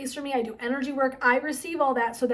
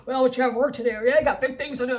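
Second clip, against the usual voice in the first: mock ego voice; 5 dB louder, faster than usual. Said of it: "in that ego state".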